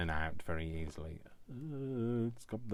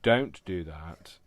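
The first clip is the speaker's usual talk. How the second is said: "Don't do that" said louder and slowly, leaning on "Don't"; a mock stern warning to himself, loud and slow; stern self-warning; 10 dB louder, much slower than usual. Vlogger warning himself not to slip up, loud and slow. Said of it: "when I say".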